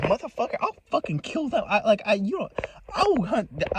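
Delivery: high-pitched, worked-up retold threat; stumbling, high-pitched angry outburst; heated threat. Retelling own threats to the caller.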